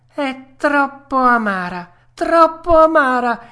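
high, loud puppet wail, dragged out; whining refusal. Acted. Puppet refusing bitter medicine, high loud whine.

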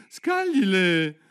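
loud, high, stretched words; called-out command. A narrator's reading. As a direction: build up to the throw, drawing it out. Turtle calling out dance steps. (grido alto e prolungato)

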